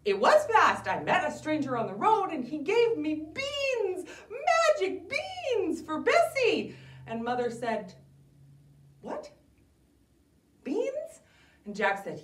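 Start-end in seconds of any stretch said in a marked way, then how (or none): 0.0-6.8 put-on high, loud excited kid voice; a proud little boy showing off his beans; Jack's voice pitched high, last words slowed; eager boy Jack. 9.0-11.2 very high squeak of disbelief; a mom who can't believe her ears; high incredulous What? Beans?; shocked mother. Acted.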